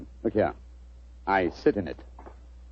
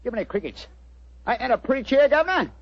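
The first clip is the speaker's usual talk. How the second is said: chirpy cockney delivery pitched up; cockney disguise; 6 semitones higher than usual. Acted. Disguised detective greets the homeowner in cockney. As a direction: play a cheeky London workman. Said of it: "What barbaric jargon do you speak?".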